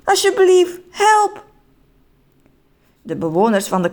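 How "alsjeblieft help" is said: high, pleading cry; desperate plea. Acted. Man falling fast begs for help, high and desperate. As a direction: beg for rescue in a raised, high voice.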